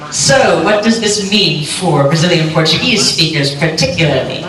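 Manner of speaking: usual speaking voice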